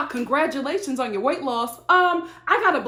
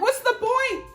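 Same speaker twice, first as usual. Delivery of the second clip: high, incredulous exasperation; mock disbelieving scold to the mother; exasperated; 7 semitones higher, slower than usual. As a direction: pitch it up in disbelief at the mom. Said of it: "So I'm like".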